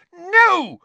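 high, very loud scream. A narrator's reading. Hermione screams no at Lupin, high and very loud. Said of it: "Hermione screamed".

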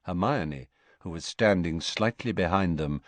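ordinary delivery, nothing marked

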